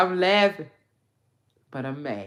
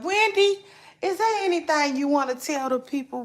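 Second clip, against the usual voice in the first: paparazzi shout; 10 semitones higher, 8 dB louder, faster than usual. Retold or imitated. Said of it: "It was like".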